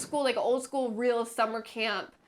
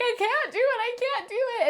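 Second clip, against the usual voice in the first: put-on high, frightened child voice; scared little kid; 12 semitones higher than usual. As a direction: whine it like a terrified toddler. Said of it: "I'm like".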